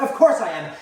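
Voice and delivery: loud, wounded retort; indignant mother. Acted. Mother to child who denies she's an afterthought, indignant. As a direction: come back loudly, deeply affronted. Mother snaps back on phone.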